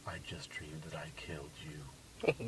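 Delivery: slow unvoiced whisper, soft; creepy whisper. Boyfriend telling her his murder dream in a slow eerie whisper. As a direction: breathe it like a threat. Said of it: "he goes".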